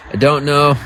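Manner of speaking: I don't know, pushed up high and loud. Real, not acted. Streamer snapping back at chat, loud and exasperated. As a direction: throw the words out, fed up. Repeats answer, second time shouted.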